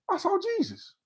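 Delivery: high, soft awestruck line; awed whisper. Retold or imitated. Telling the host he saw Jesus, awestruck and soft. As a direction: gasp it out in wonder. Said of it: "I said".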